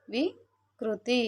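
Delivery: వికృతి stretched very slowly, syllable by syllable; slow demo pronunciation. Shown as a demonstration. Teacher modelling the word for children, very slow. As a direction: sound out వికృతి slowly for the class. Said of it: "పలికేటప్పుడు".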